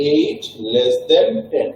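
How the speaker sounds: slow, careful teacher pronunciation; slow demo reading. Shown as a demonstration. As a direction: drag each word like a model reading for students. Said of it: "উচ্চারণ করতে করতে আস্তে আস্তে ডান দিকে যাব".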